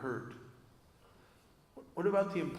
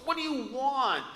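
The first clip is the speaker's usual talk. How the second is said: much higher, loud, alarmed mother's cry; panicky mother; 12 semitones higher, 10 dB louder than usual. Retold or imitated. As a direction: snap it out like a frightened mom. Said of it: "oh, panicky".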